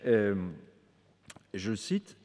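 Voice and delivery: ordinary talk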